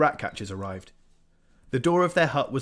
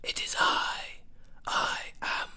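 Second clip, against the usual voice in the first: slow, airy, sinister whisper; a menacing voice creeping into his mind; eerie whispering Spider; slower than usual. A narrator's reading. The Spider voice speaks in his head.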